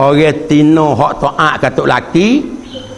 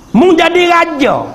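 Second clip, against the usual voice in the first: emphatic preaching; 10 semitones higher than usual. Acted. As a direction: hit it like the punchline of the sermon. Preacher repeats the Prophet's saying.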